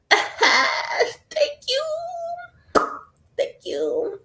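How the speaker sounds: laughing cry of thanks. Real, not acted. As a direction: burst out thanking through tears.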